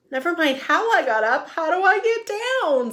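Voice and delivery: high, loud plea from up the tree; worried shout. Acted. Wilbur stuck up a tree pleading with Elmer, high and loud. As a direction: call down anxiously.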